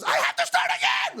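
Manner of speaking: very high, hammed-up scream; frustrated shriek. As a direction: shriek it in mock meltdown. Woman frustrated by makeup mistake.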